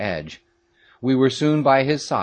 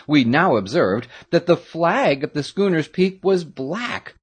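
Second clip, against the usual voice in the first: usual speaking voice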